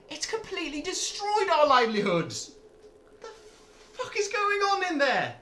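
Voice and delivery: high, furious yelling; angry shout. Acted. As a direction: scream it at the speaker. Angry miner shouting at orc.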